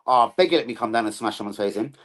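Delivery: ordinary talk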